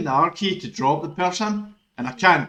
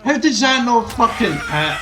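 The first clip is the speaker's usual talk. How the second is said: loud, higher furious yell; frustrated shout; 7 semitones higher, 7 dB louder than usual. Real, not acted. Streamer raging that his hit missed, furious shout. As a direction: shout it at the screen in disbelief.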